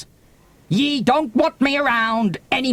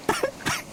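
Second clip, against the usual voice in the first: high sob; 8 dB quieter than usual. Acted. Sob within crying.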